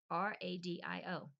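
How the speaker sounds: normal talking voice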